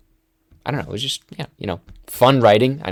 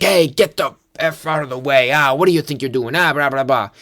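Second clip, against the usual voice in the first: higher-pitched hammed-up road rage; mock irate mayor; 4 semitones higher, 3 dB louder than usual. Scumbag mayor honking at someone in the way, mock irate. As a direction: rant like an obnoxious movie mayor. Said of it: "an absolute scumbag of this movie".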